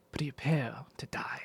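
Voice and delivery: breathy hushed threat; soft whisper. Retold or imitated. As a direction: whisper it slowly and softly. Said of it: "he says that so softly".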